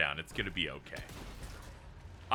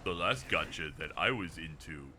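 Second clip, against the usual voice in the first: lower, softer, hushed and shaky confession; 8 semitones lower, 7 dB quieter than usual. Retold or imitated. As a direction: imitate a friend's hushed, shaky admission. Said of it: "like, hushed tone, shaky voice of going".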